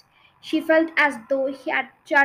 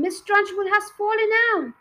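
high, loud excited scream; schoolboy screaming with glee; 9 semitones higher, 5 dB louder than usual. A narrator's reading. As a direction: shout the news to the class.

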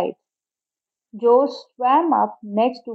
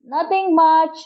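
shouted back; 5 semitones higher, slower than usual. A narrator's reading. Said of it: "he shouted back".

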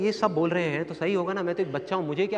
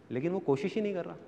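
much softer, measured; hushed regret; 10 dB quieter than usual. Real, not acted. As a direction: drop to a soft, quiet voice. Sober moral after loud climax.

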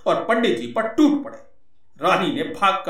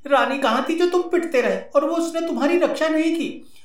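high woman's voice, sarcastic; taunting wife voice; 10 semitones higher than usual. Acted. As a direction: jab at him as the wife.

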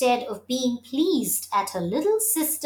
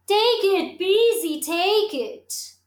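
high, bright singsong call; tinkling stream voice; 7 semitones higher than usual. A narrator's reading. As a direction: sing it out like a little stream. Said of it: "tinkled the stream".

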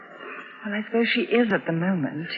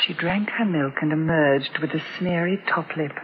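quiet story narration; 6 semitones lower, 3 dB quieter than usual. A narrator's reading. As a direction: softly read inner narration, a little subdued. Mother narrates between scenes.

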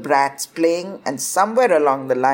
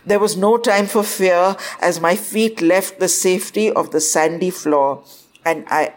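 plain reading voice

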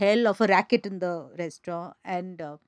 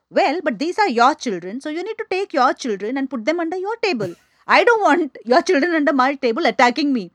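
higher, louder sharp retort; snarling back; 5 semitones higher, 5 dB louder than usual. Retold or imitated. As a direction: tell the mother off, growing louder. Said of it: "And I have snarled".

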